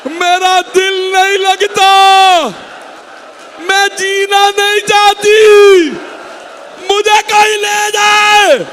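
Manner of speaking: high, slow, loud wailing cry; weeping lament. Acted. Mother clutching her sons' prayer mats, wailing. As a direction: wail it out, stretching every word in grief. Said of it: "रो रो के कहने लगी".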